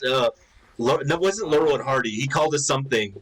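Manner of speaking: ordinary delivery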